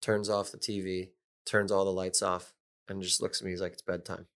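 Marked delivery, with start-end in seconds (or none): 3.7-4.2 low, soft matter-of-fact announcement; deadpan imitation. Veteran roommate calling lights out to a rookie, deadpan.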